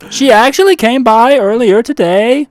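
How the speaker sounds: loud clerk character. Acted. Clerk Steve brags about his name tag and car, loud and deadpan. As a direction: play the earnest oddball attendant.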